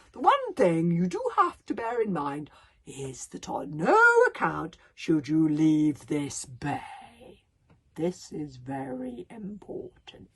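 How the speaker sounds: slow, drawn-out, weighty warning; grave warning. Acted. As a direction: stretch each word out for emphasis. Gobber gives a grave warning.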